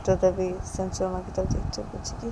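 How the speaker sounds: ordinary delivery, nothing marked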